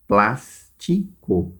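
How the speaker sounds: "Plástico" slowly split into three syllables, with pauses. Shown as a demonstration. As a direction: break "Plástico" up as plás, ti, co. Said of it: "são três sílabas".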